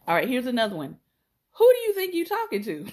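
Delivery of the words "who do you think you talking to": high, loud, laughing challenge; indignant shout. Shown as a demonstration. To someone talking out of turn, a loud, high, laughing challenge. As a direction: rear up with mock outrage, voice high and loud. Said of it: "I say that".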